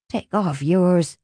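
usual speaking voice

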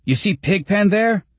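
very high, excited question; exclaiming. A narrator's reading. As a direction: nudge a friend with eager wonder. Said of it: "Greg exclaimed".

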